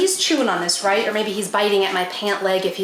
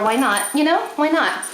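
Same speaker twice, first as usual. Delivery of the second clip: ordinary talk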